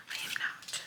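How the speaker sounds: whispered confession; a sheepish whispered admission. Real, not acted. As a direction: drop to a guilty whisper. Admitting she doesn't drink water.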